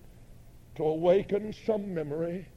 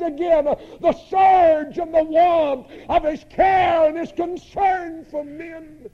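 high, shouted sermon climax; surging revivalist fervor; impassioned preaching; 9 semitones higher, 10 dB louder than usual. Real, not acted. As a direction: proclaim it at full voice. Preacher at full force.

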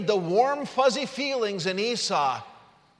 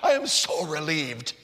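hammed-up weepy sigh of relief; a touch of comic weeping; mock tearful relief; 3 semitones lower than usual. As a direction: let the relief sag out after mock sobs. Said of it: "why Jacob was weeping. He's like".

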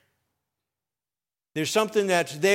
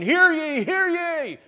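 town crier call; 9 semitones higher, slower than usual. Retold or imitated. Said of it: "As the town crier would go forth".

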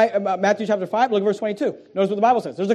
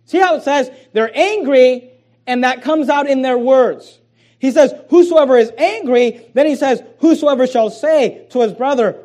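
louder, driving preacher's read; preaching; 3 semitones higher, 5 dB louder than usual. Acted. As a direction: press the verse home to the congregation. Preaching scripture with rising force.

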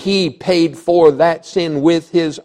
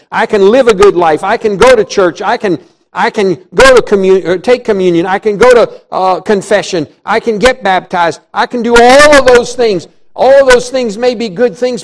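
loud, raised preaching voice; full-force preaching; 5 semitones higher, 7 dB louder than usual. Real, not acted. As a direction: proclaim it forcefully to the congregation.

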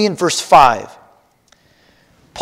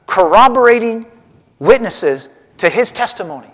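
ordinary delivery

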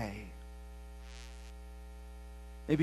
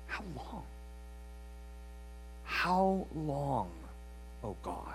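hushed cry; 5 semitones lower, 15 dB quieter than usual. Acted. Quoting the cry of the heart.

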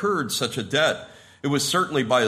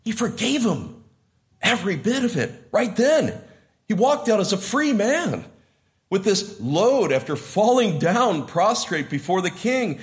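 joyful preaching; 7 semitones higher than usual. Real, not acted. Preacher's rising joy.

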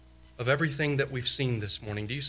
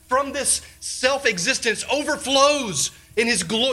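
raised, urgent preaching voice, pitched higher and louder; full-force preaching; 10 semitones higher, 7 dB louder than usual. Acted. Preacher urging the ladies toward God's holiness, fervent. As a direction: drive it home from the pulpit.

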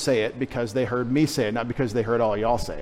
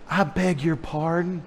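slow, prim, scandalized mother; a proper Southern lady clutching her pearls, slowly; shocked genteel mother; 3 semitones higher, 4 dB quieter, slower than usual. Retold or imitated. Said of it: "it sounded something like that".

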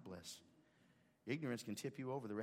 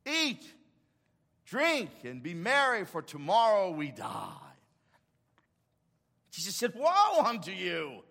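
loud, high, fervent pulpit preaching; full-force preaching; 10 semitones higher, 10 dB louder than usual. Acted. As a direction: preach it hard, calling the church to give.

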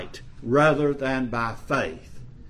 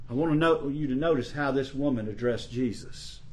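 ordinary talk

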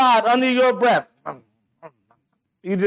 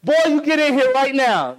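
raised, higher barked order to a son; angry parent command; 6 semitones higher than usual. Retold or imitated. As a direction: call the boy in like a cross father. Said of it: "I come out and say".

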